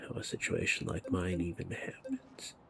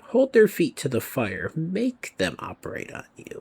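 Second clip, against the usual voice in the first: higher, louder imitation of a pushy doctor; mock-emphatic doctor quote, pitched up; insistent doctor; 8 semitones higher, 7 dB louder than usual. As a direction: urge it forcefully, mimicking a doctor's advice. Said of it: "this doctor I was talking to yesterday is literally like".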